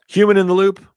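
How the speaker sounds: high, loud topic announcement; announcing topic. Real, not acted. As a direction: announce the new heading. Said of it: "announcing new topic".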